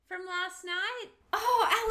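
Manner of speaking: ordinary delivery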